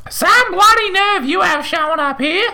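an outraged mother screeching in the doorway. A narrator's reading. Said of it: "she screeched".

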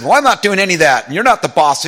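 mock a chaplain yelling back at his overseer; imitated angry yelling. Defiant chaplain refusing the new overseer's orders, imitated yelling. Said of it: "yelled at me in front of the other senior chaplains".

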